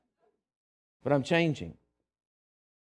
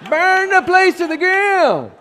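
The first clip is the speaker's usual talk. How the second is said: much higher, louder, slow yell; furious inner shout; 9 dB louder, slower than usual. Retold or imitated. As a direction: let the hidden rage burst out. The whole time I'm still angry.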